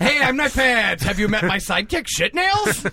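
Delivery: high, mock-heroic shouted introduction; Knife Pants character. Knife Pants introduces his sidekick, high hammed-up hero voice. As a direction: show off your sidekick, pitch climbing to the name.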